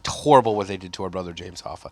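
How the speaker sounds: low, soft hushed condolence; mock sombre union mourner. Bartender to a union guy, lightly hushed mock mourning. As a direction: mourn Hoffa like family, hushed. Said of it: "the union bit".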